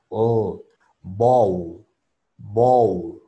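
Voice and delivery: "bow" closed with a thick dark L, as in bowl; fat English L. Shown as a demonstration. Teacher explains the phonemes, fat final L on "bow". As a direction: end "bow" on a heavy back-of-mouth L. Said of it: "la L es gorda en inglés".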